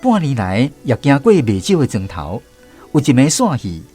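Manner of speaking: ordinary talk, nothing marked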